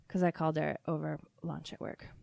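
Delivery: ordinary talk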